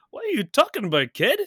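high, loud acted query; mock bewildered passer-by. Host mimics the local mailman walking past Toby, mock bewilderment. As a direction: call it out in hammed-up puzzlement.

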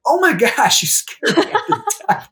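high, loud startled cry breaking into a laugh; startled yelp. Retold or imitated. Retelling being startled by a stranger, high loud yelp. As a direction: jump out of your skin and squeal it.

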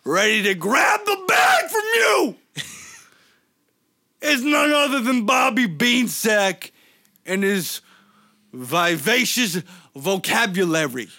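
shouted high wrestler promo voice; hammed-up wrestling trash talk. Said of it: "cut a promo".

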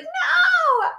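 very high, drawn-out retold cry; mock panicked cry. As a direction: reenact balking at the jump. Retold panicked refusal at the jump.

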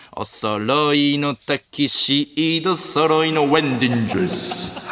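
slow, over-pronounced タキシード and ウェンディングジュース; showy comic over-enunciation, ending on a stretched ウェンディングジュース; Wagyu pronunciation bit. Retold or imitated. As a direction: draw out タキシード with exaggerated mock-English sounds. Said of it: "発音よ".

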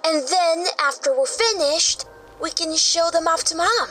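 put-on high, eager child character voice; excited kid. Child planning to show masks to Mom, excited kid.

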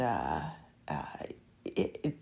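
ordinary talk, nothing marked

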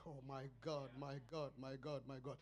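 hushed, low "my God" aside; murmured aside. Real, not acted. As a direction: mutter it under your breath.

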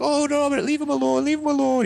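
very high, wailing mock plea; pleading mom. Mom defending her kid from dad, high imitated pleading. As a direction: plead in a put-on high mother's voice. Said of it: "she'd just be like".

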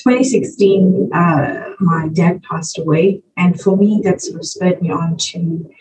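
ordinary talk, nothing marked

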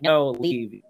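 loud firm "leave"; loud command. Retold or imitated. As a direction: order him away loudly. Fox ordered away.

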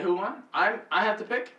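normal talking voice

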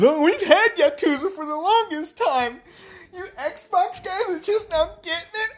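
high, laughing smug fanboy voice; mocking PlayStation fan. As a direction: sneer at Xbox players like a gloating kid.

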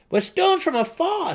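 high, loud gnome guess; eager gnome. A narrator's reading. Seldig eagerly guesses the method, high and loud. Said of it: "Seldig asked".

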